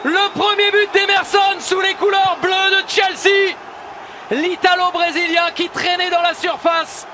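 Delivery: excited sports commentary. Real, not acted. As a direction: shout the scorer's name with joy. Goal scored, commentator shouts.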